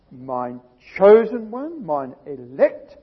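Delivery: solemn scripture proclamation. Acted. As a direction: savor each word as you proclaim it. Preacher reads scripture with force.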